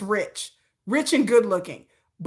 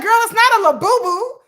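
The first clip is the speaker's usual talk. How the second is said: incredulous; 11 semitones higher, 8 dB louder than usual. Retold or imitated. Mocking friend's collectible plan.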